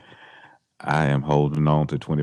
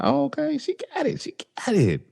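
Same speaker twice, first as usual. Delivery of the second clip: impressed surprise. Retold or imitated. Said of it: "I was like".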